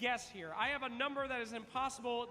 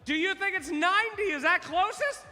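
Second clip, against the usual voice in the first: crowd-rousing call; 4 semitones higher, 9 dB louder than usual. Real, not acted. Host asks crowd to judge.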